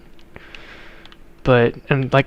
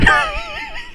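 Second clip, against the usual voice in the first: a sudden shrill burst of laughter, pitched way up; high squealing laugh. Real, not acted. Playing a farm game for viewers, he bursts into a high laugh. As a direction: let a high giggle escape mid-sentence.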